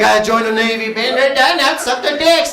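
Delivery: high, loud cocky voice; mock sailor. Heckler bragging about joining the Navy, loud and high. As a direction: brag it loudly like a cocky sailor. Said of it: "He's like".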